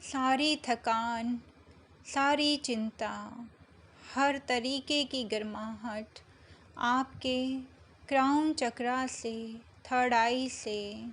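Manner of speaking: slow meditation voice with long pauses between phrases; unhurried, measured meditation guidance; guided meditation. Acted. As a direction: guide them gently into calm, drawing out the words, phrase by phrase.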